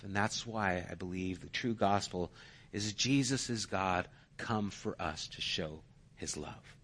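soft, earnest gospel message; hushed preaching. Acted.

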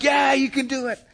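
high, loud cheer from the stands. Retold or imitated. Fans cheering a girl after a good serve, high loud cheer. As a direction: yell encouragement at the player.

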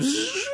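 high buzzing zip of a tape skipping ahead; fast-forward buzz. Pretend. Preacher skipping ahead in the story, high buzzing zip. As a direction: zip through the time skip with a high buzz.

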